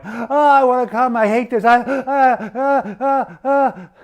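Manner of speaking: high, loud, hammed-up moans and gasps; mock ecstatic moaning. Imagining the general forced to watch, hammed-up high moaning. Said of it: "all of a sudden you're going".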